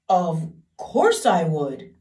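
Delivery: loud, slow tearful cry; crying king. Acted. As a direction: cry it out, longing. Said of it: "cried the king".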